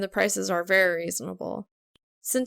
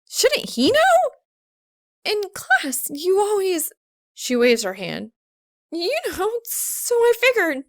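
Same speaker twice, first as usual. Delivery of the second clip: high, rising, increasingly loud; cornered and flailing for words; flustered; 8 semitones higher, 4 dB louder than usual. A narrator's reading. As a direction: Maka blurting out her reasoning.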